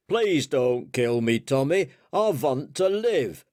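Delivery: put-on rough German-accented plea; attempted German accent. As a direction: plead in an amateur German accent. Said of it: "I can't do the accent, but I'll try anyway".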